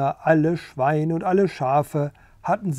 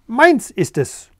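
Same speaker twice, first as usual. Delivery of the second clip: loud, higher hen's scream; 7 semitones higher, 7 dB louder than usual. Acted. Hen Gundula screaming over the egg, loud. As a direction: shriek it at the other hen. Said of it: "schrie Henne Gundula".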